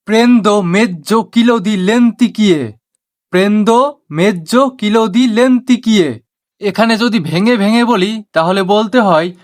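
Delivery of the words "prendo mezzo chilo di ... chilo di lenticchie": very slow, word by word Italian phrase; slow teaching demo. Shown as a demonstration. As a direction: spell it out slowly for learners. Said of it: "Italian ভাষায় বলা হয়". (ধীরে ধীরে শেখানোর উচ্চারণ)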